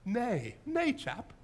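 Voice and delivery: British "chap" reply, rising high; mock British accent. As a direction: refuse in a playful British voice. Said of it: "speak in British accents".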